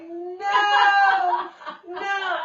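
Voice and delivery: high, loud alarmed shout. Retold or imitated. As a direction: stop a kid heading for the bushes. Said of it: "and I'm like".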